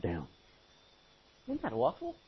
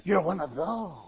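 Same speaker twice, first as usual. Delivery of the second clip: a hint of a scornful coworker voice; sneering coworker. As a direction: look down on the believer.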